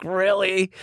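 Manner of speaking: high-pitched disbelieving retort; incredulous. Retold or imitated. Reluctant man answering his friend's photo request, incredulous. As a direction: act out dismay, shooting the pitch way up.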